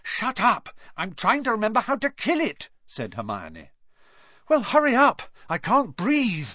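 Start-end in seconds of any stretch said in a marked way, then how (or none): 0.0-2.7 high quick retort; snapping. Acted. Hermione snapping at Ron while trying to think, high quick retort. 4.5-6.5 gasped. Acted. Harry choking in the plant's grip, loud gasping plea. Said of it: "Harry gasped".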